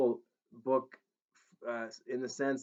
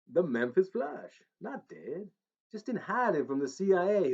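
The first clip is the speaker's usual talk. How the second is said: [name] Tennessee twang; 3 semitones higher than usual. Acted. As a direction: spin the alien tale as the King. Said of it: "his Tennessee twang".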